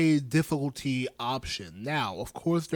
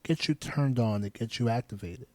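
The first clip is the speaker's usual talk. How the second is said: calm and direct, lingering with long pauses; playtime voice; 4 semitones lower than usual. Shown as a demonstration. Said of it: "Do the playtime voice".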